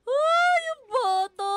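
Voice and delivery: sudden high scream of fright; startled scream. Real, not acted. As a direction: shriek at the sudden jump scare. Sudden scare in horror game. (gulat na sigaw)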